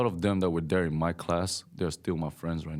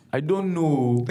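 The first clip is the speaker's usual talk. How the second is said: higher, louder pidgin with a long O; pidgin I don't know; 8 semitones higher, 6 dB louder, slower than usual. Retold or imitated. Showing friends the pidgin O ending, higher and louder. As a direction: stretch the O in know. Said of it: "they gotta add the O in the end".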